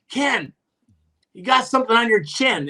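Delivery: high-pitched, teasing call; mock calling out. Chirping the coach from the ice, high mock call.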